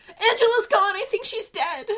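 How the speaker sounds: high-pitched panicked report; high, urgent and upset; distraught. Acted. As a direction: break bad news in alarm. Reporting friend's death, upset.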